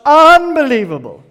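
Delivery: high, loud, swooping down; thrilled exclamation. Acted. Bike lover hears a superbike pull off, thrilled exclamation. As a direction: cry out in awe of the engine.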